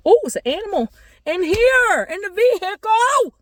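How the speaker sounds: very high startled yelp of words; real startled outburst. Real, not acted. Teller spots an animal in the vehicle, startled. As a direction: exclaim in alarm, way up high.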